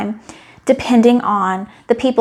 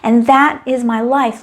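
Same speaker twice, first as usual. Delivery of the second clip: ordinary talk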